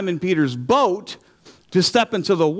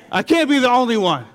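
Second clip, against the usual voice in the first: yelling at God; 7 semitones higher than usual. Retold or imitated. Protesting to God that he is alone, raised frustrated voice. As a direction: throw it at the sky in frustration. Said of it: "I'm yelling at God".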